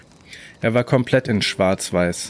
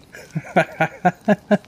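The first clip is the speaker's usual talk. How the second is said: high, eerie cackling laugh; long evil laugh; 11 semitones higher than usual. Acted. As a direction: let out a drawn-out horrific cackle. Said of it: "einem langen, entsetzlichen Lachen".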